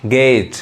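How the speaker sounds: गेट as English "gate", vowel held; stretched गेट, resting on the vowel; long sound. Shown as a demonstration. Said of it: "थोड़ा आवाज़ थोड़ा ठहराव के साथ".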